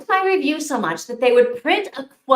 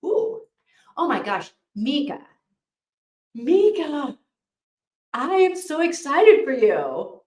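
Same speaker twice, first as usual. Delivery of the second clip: gushing publisher; 5 semitones higher, 3 dB louder than usual. Retold or imitated. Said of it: "the publisher was like".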